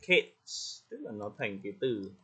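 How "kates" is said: "cakes" turned into "kates"; wrong final sound. Retold or imitated. Teacher repeating student's error, "cakes" ending t-s. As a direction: end "cakes" on ts, losing the K. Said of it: "Chị nói thành cakes".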